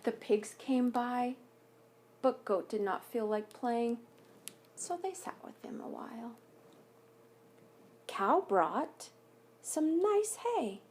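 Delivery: put-on gentle read-aloud voice for children; story narration. A narrator's reading. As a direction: read it like a picture book at story time. Reads picture book aloud.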